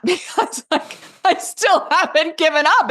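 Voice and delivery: laughs out the words, pitch up; giggling through the line; laughing. Real, not acted. Laughing at her own persistence.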